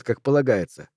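ordinary delivery

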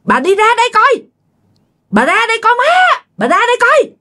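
high-pitched ghostly call for mother-in-law; high, insistent summons. A narrator's reading. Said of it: "rồi nghe cái giọng kêu".

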